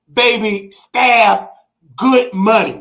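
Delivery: ordinary delivery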